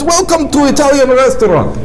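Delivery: put-on high, thick Russian accent. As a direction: play the host with a heavy Russian accent. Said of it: "Greets you with thick Russian accent".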